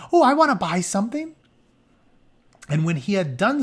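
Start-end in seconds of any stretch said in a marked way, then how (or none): 0.1-1.3 put-on high, fast girlish squeal; mock girly shopper voice; eager shopper girls. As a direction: play the excited girls rushing to buy. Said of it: "all these women come out saying".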